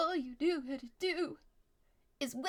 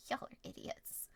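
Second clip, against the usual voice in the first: whispered quote; 10 dB quieter than usual. Retold or imitated. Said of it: "He's just like".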